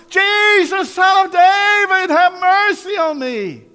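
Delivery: full-voiced, very high drawn-out holler. Blind beggar desperate to reach Jesus, hollering. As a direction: holler each word slowly at the top of your voice. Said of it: "he hollered out louder".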